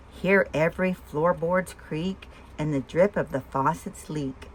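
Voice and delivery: usual speaking voice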